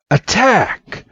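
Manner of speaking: high-pitched attack call; acted command at normal volume; battle command. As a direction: order your fighter to strike. Blue commands Charmander.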